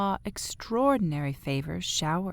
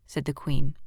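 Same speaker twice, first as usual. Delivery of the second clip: usual speaking voice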